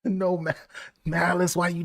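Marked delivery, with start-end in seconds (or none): none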